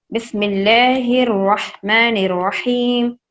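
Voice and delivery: slow recited bismillah; Quranic recitation. Shown as a demonstration. Teacher opens the lesson's recitation, slow and intoned. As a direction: intone it slowly.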